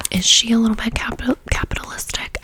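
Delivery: quick, punchy stage whisper; whispered excitement. Real, not acted. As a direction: blurt it out in an eager whisper. Gushing about an album, teasing.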